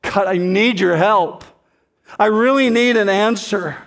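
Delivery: imitating Daniel's pleading prayer. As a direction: cry out to God for help as Daniel. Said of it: "it sounds something very much like this".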